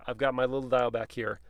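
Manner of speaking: ordinary talk, nothing marked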